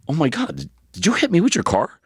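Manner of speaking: high, disbelieving realization; retold dawning shock. Boyfriend realizing his girlfriend hit him, high disbelief. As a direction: let it dawn on you in shock.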